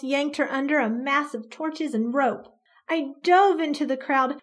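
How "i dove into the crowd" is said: ordinary delivery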